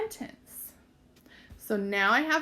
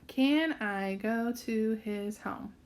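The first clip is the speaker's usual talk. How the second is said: slow read-back; slower than usual. Shown as a demonstration. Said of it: "are they in order".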